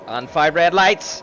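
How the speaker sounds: slow, loud, rising race-start call; motorsport commentator. Acted. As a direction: build up the start like live commentary. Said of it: "I will do my best to commentate".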